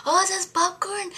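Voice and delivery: high, loud imitation of him; delighted surprise. Imitating Jesse thrilled at the popcorn, high. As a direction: bounce up, thrilled by the gift.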